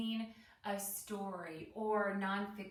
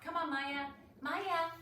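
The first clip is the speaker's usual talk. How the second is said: raised, higher call to the dog; 7 semitones higher, 3 dB louder than usual. Real, not acted. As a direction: call Maya over, louder and higher. Calling the dog during class.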